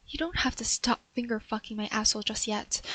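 soft, whispered give-in; stuck woman whispering for him to keep going; husky whisper. Acted. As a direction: let him carry on, softly. Whispered plea for more.

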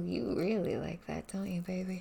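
ordinary delivery

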